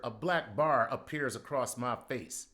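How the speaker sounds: ordinary delivery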